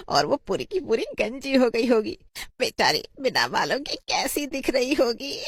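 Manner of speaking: high, mocking voice breaking into laughter; gleeful mockery, rising in pitch; gloating schoolgirl. A girl jeers that Sudha will skip school, gleeful mockery.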